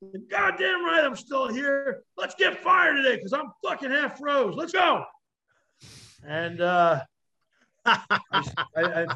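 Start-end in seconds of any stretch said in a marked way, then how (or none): 0.0-5.0 high, loud triumphant yell, slowing on the middle line; hammed-up screaming from the cold survivor; retold scream. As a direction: bellow it out like a victory cry, voice straining. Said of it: "I scream and I go".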